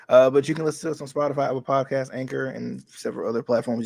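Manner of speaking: ordinary talk, nothing marked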